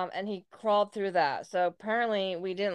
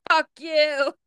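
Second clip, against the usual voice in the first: higher, louder defiant yell out the window; mock yell; 8 semitones higher, 8 dB louder than usual. Roommate yelling at the cops' spotlight, loud mock shout. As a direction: shout it at the cops. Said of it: "flipped him off and was like".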